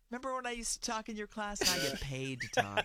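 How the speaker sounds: mock gloating at a teacher. Teasing old teachers she meets.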